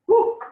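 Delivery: high, loud, quick "woo"; sudden high hoot; short whoop. Real, not acted. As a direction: blow off the onion sting. Reacting to onion fumes.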